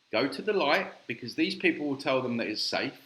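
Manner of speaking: ordinary talk, nothing marked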